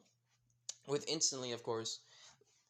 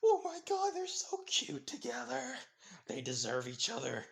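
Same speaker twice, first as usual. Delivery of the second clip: high squealing gush, laughing and near tears; excited squeal; 9 semitones higher, 4 dB louder than usual. Acted. A girl watching the couple, squealing with excitement. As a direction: squeal like a fangirl over a cute couple. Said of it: "internally, like, squealing with excitement".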